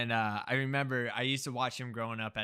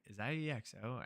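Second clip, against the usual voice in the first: hushed, much softer aside; retold whisper; 8 dB quieter than usual. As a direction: re-enact a discreet question to a neighbor. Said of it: "I whispered to the person".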